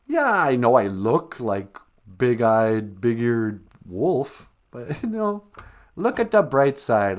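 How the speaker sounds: a wolf badly pretending to be granny; wolf faking grandma.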